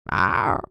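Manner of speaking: very low playful rawr; a deep doggy growl. Acted. Big dog answers again.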